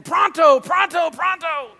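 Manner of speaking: high, loud urgent shouting; frightened shout. Retold or imitated. Friend runs out of the store shouting for help, frightened and loud. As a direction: yell for help in a panic, fading on the last. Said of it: "He was afraid".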